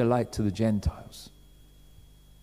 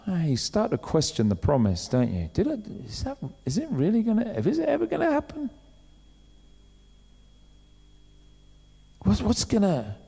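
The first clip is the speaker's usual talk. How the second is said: much higher, softer, wavering questions; doubting worry; 6 semitones higher, 4 dB quieter than usual. Retold or imitated. Waiting believer questioning the promise, high anxious doubt. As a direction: let the doubt creep up high and small.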